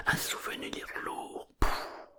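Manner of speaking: usual speaking voice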